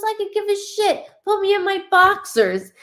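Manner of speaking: lightly voiced, loud and dismissive; channelling the spirit. Medium voicing the departed father, loud and dismissive. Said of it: "I'm so sorry I'm talking like this".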